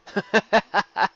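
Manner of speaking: easy confident chuckle; confident laugh. A narrator's reading. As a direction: laugh it off, sure of himself. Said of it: "He let out a confident laugh".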